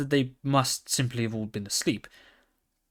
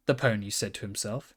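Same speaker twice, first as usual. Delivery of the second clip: ordinary delivery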